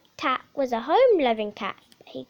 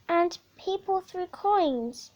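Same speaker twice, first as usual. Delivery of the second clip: usual speaking voice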